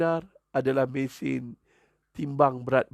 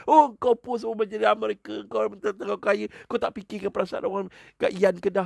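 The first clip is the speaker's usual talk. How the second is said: high-pitched, indignant rant; hammed-up high-pitched accusation; mock offended; 7 semitones higher than usual. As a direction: imitate a touchy netizen ranting. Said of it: "Dia cepat sentap, cepat terasa".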